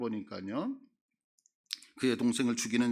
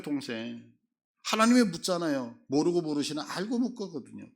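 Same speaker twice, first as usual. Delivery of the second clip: ordinary talk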